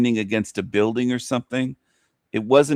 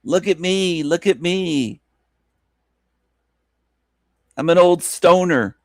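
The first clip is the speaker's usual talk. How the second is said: mocking impression; 6 semitones higher than usual. Mocking the streamer again.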